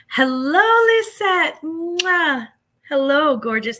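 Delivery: high, loud gushing greeting with an air kiss; squealed hello and a blown kiss. Real, not acted. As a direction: greet a favourite viewer like a long-lost friend. Greeting a viewer on live.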